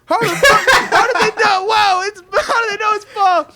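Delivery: very high, loud, laughing squeal of disbelief; hammed-up panicked shriek, cracking up; mock family panic. Relatives caught out by the child, mock panicked disbelief.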